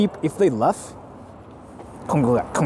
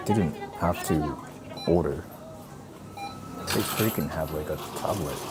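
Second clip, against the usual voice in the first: hushed whisper in the restaurant; 6 semitones lower, 10 dB quieter than usual. Real, not acted. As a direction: whisper so nobody hears.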